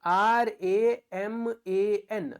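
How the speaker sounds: each letter spelt out slowly and apart; slow spelling. Shown as a demonstration. Teacher slowly spells a name for the students. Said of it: "रमन नाम अगर आप लिखेंगे तो आप क्या लिखोगे".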